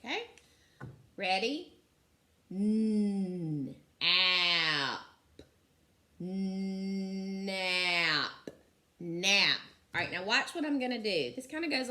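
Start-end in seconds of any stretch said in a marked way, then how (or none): 2.5-9.6 each sound stretched apart, then blended; sounding out slowly. Shown as a demonstration. Teacher to class, sounding out the word nap. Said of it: "Let's sound it out".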